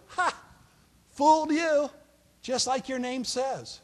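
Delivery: high, loud mocking cackle of a trickster; gloating taunt. Father-in-law taunting the deceived groom, loud and gloating. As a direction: crow over the man you just fooled.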